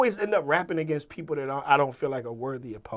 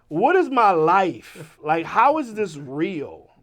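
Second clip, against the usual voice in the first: drawn-out, loud, incredulous questions; retold bewilderment, slow and emphatic; stunned disbelief; 3 semitones higher, 8 dB louder, slower than usual. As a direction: stretch it out in amazement. Retold stunned reaction to the call.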